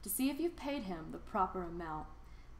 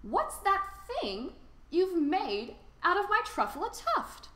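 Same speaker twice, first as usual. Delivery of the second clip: higher, louder outraged shout, rising to a squeak on the last word; upset shout; 8 semitones higher, 6 dB louder than usual. Acted. The Lorax shouting at the Onceler about his tuft, upset. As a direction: puff up and shout at him. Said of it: "as he shouted and puffed".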